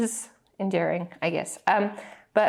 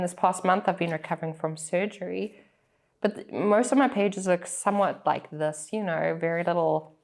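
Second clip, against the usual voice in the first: ordinary delivery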